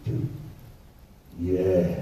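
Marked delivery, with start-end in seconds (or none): 1.4-2.0 put-on lower, eerie child's yes; a hint of a spooky little boy; creepy low kid. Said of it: "how his voice changed".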